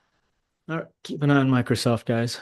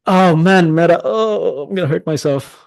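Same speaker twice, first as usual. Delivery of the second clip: higher, louder anguished outburst; real exasperation; 6 semitones higher, 7 dB louder than usual. Real, not acted. Trader groaning at a missed Meta entry, exasperated outcry. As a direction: burst out at the missed chance.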